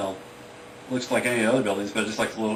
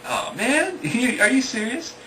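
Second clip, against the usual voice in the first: put-on high-pitched, drawn-out kid's protest; acted childish dismay, pitched way up; upset little kid; 5 dB louder, slower than usual. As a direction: whine in disbelief as an upset kid.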